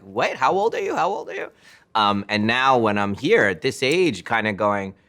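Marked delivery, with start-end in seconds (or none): 0.0-1.5 high, quick mock disbelief, voicing other people; astonished stranger. World reacting to a young-looking director, mock disbelief. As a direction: imitate incredulous people asking, much higher-pitched. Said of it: "how the world related to me of like".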